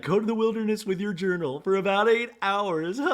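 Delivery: high-pitched put-on voice; Heather. Heather sending everyone off with journals, high mock voice. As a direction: lift it high and play it for laughs. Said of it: "And Heather's like".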